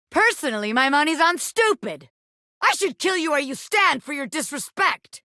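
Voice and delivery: usual speaking voice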